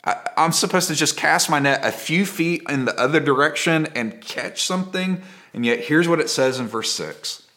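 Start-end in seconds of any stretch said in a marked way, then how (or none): 0.0-5.2 higher-pitched, incredulous questioning; sarcastic disbelief. Acted. Preacher as doubting fisherman told to recast his net, sarcastic. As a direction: scoff at an absurd suggestion, voice rising. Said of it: "I get a little bit more sarcastic".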